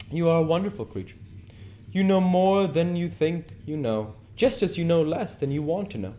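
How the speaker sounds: a lifted, persuasive aristocrat; lightly voiced charming lord; Lord Henry voice. Lord Henry flattering Dorian in the garden, lightly voiced lord.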